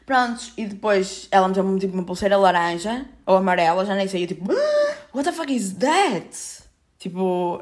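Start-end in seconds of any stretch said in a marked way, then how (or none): 4.6-5.1 high startled gasp; sharp shocked gasp. Retold or imitated. Seeing the urgent wristband, startled gasp. 5.1-6.6 slow, drawn-out shocked question; alarmed disbelief. Retold or imitated. Seeing the orange wristband, slow shocked disbelief.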